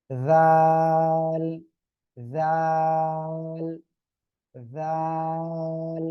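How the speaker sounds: জাল with a very long held vowel, tongue starting on upper teeth; three-alif long জাল. Shown as a demonstration. Teacher models for the class to repeat, long-drawn chanted জাল. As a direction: stretch the vowel of জাল evenly on one pitch. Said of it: "তিন আলিফ টান".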